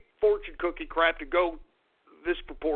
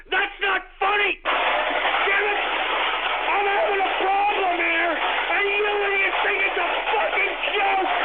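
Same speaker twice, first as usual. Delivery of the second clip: furious yell; 11 semitones higher, 4 dB louder, slower than usual. Acted. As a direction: voice jumps high, loud angry shouting. Host ranting at Twitter trolls.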